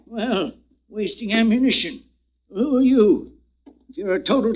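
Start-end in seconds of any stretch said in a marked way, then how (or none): none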